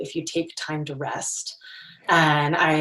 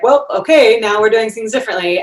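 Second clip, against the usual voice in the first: mock decisive; 6 semitones higher, 10 dB louder than usual. Self-quote, decisive new start.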